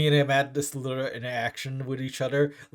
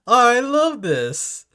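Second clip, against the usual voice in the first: delighted; 11 semitones higher, 10 dB louder, slower than usual. Real, not acted. Delighted at gift in game.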